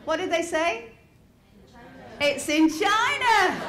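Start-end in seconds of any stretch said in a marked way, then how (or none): 2.2-3.6 friends' guess. Retold or imitated. Friends answering, mocking tone.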